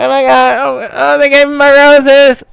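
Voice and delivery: high, loud, overwhelmed wail; mock tearful joy. Winner on stage receiving roses, hammed-up tearful. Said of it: "gonna have tears coming out my eyes".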